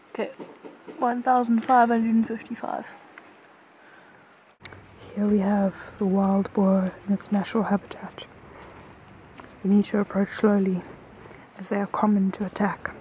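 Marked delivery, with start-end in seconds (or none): 5.2-12.9 calm wildlife documentary narrator; nature documentary. Pretend.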